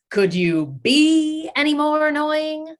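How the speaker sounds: high, loud sarcastic stress on "be"; Chandler impression. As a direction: do Chandler's mock-exasperated catchphrase. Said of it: "like, Chandler from Friends".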